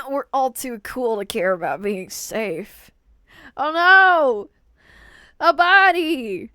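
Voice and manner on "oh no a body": loud, higher hammed-up gasp of shock; a louder, higher theatrical oh no; mock horror. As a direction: play at being horrified by the find. Reacting in mock horror to scene.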